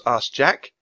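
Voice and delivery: plain reading voice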